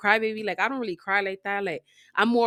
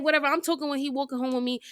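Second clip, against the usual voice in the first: ordinary talk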